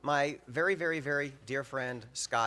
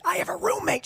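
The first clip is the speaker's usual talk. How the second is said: high, loud protest; panicked yelling; 6 dB louder than usual. Retold or imitated. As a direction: yell it back in alarm. Panicked phone quarrel with mom.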